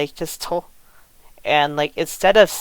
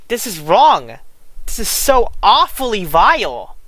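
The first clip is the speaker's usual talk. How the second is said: indignant, with swooping stresses; emphatic condemnation; 4 semitones higher, 3 dB louder than usual. Real, not acted. Commentator condemning the defence of an abuser, emphatic indignation. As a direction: condemn it in appalled exasperation.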